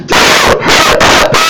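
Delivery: very high, slow, strained screech; mom's shrieking scream. Retold or imitated. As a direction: shriek it at the top of your lungs.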